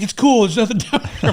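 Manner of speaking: high, loud imitated call through laughter; amused, high-pitched mock reassurance; retold call-up. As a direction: call up from below, laughing as you retell it. Retold call from below after falling.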